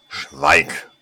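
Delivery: a loud, sharp bark of silence; angry shout. Acted. Lady Contagion furiously silencing the begging saleswoman, shouting. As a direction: cut her off with fury.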